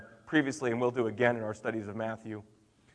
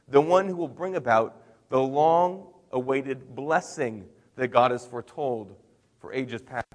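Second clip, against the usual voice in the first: normal talking voice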